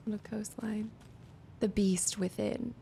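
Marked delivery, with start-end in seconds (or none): 0.1-0.9 whisper. Real, not acted. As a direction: read it in a soft whisper. Reading game titles under breath.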